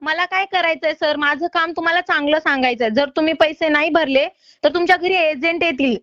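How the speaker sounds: ordinary talk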